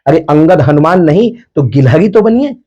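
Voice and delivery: normal talking voice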